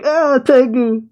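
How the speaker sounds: high, drawn-out startled cry; acted scare, pitch shooting up; frightened cry. Tama frightened at seeing Tengu, frightened cry. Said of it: "And Tama's frightened".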